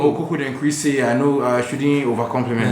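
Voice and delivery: high Nigerian Pidgin storytelling; Nigerian accent. Shown as a demonstration. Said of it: "This is my Nigerian accent".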